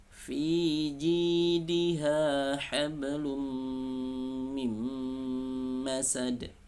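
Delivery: slow, high chanted recitation; Quran recitation. Shown as a demonstration. Teacher recites the verse for students.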